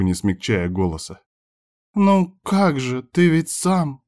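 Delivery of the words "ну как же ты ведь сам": slow, higher woman's voice, hesitating; mumbling, faltering. A narrator's reading. Woman confronted by him on the phone, faltering. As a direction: stall and stretch the words, pitched up. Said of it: "начала мямлить".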